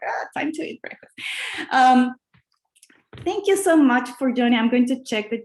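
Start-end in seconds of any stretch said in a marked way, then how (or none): 0.1-1.1 soft whisper to herself. Retold or imitated. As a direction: whisper it like a thought. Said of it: "I'm like".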